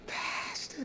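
hushed, tearful plea to the pastor; soft mock sob on the word; imitated weeping. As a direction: choke out the word like a crying man. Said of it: "that's the way he would come".

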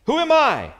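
an anxious Moses crying out to God; Moses pleading. Retold or imitated. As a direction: read it as an alarmed Moses doubting himself. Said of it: "Moses said unto God".

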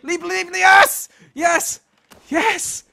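high, loud jubilant cries; high-pitched bursts of triumph; triumphant cheer. Real, not acted. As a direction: cheer as the jump lands. Makes the final jump.

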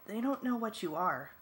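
tight, altered voice, a touch of fear; barely manage to get it out; frightened, held-back protest; struggling to speak. A narrator's reading. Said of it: "Ax managed to say".

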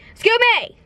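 high, sudden excuse me, blurted at a stranger; mock loud excuse me. Retelling a guy who called out to her in Walmart, mock loud. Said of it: "Like, super loud".

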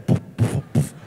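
mock car engine coughing puf puf; breathy imitated engine misfire; sputtering engine noise. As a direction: spit out the puffs like a dying motor. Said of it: "Pero viene así, puf".

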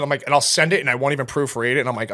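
normal talking voice

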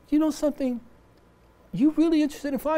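put-on high-pitched voice of the old Iowa farmer. Retelling the Iowa farmer's words to the host, high voiced. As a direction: lift the voice way up to play the old farmer. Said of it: "this guy said".

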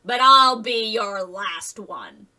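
slow, loud gloating threat; drawn-out wolfish menace; grinning wolf. A narrator's reading. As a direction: relish the threat as the big bad wolf.

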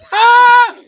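loud, high shriek; frightened scream. Real, not acted. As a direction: scream in alarm. Fighting a creeper.

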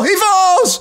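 high, loud, laughing burst; excited laughing shout. Real, not acted. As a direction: blurt it out laughing. Clip payoff, laughing.

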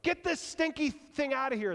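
loud, high, scornful shout; disgusted crowd. Retold or imitated. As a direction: throw the stinky donkey out. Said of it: "they're all like".

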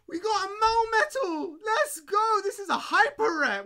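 very high and loud, bursting with excitement; thrilled shouting. Real, not acted. Streamer showing a hyper rare Melmetal to chat, thrilled and shouting.

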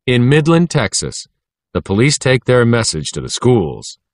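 ordinary delivery

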